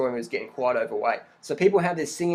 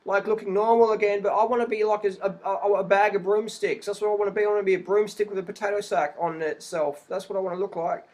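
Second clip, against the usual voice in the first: higher, wistful mocking voice; higher mocking voice of someone who wants to be stick-thin; mock anorexic; 6 semitones higher than usual. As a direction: play a dreamy dieter, lightly sending her up. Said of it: "you've got the anorexics go".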